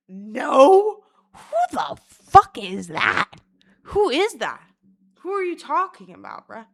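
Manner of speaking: loud, high, drawn-out indignant questions; offended disbelief. Acted. Stranger called a crotch goblin, indignant disbelief. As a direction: act the baffled, affronted stranger.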